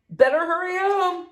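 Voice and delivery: high, drawn-up call; yelled warning. A narrator's reading. Big Sam sends Eli and Dad home, yelling. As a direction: call it out high to send them off. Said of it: "Big Sam yelled".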